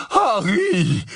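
panting puffed-out call; out of breath. Acted. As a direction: gasp it after running. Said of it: "dit-il à bout de souffle".

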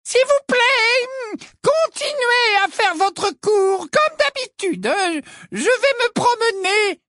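very high, slow over-articulated voice; high slow sugary condescension; slow, resonant Umbridge. A narrator's reading. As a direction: talk down as if to a child. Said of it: "de sa voix lente et sonore".

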